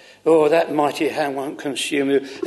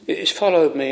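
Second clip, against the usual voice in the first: ordinary talk, nothing marked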